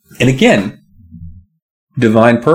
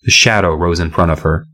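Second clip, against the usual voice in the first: usual speaking voice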